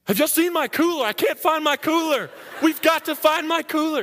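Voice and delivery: high, loud, panicky calls; frantic yelling. Retold or imitated. Marine on the logjam shouting to his friends about his cooler, high and panicky. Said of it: "He's like".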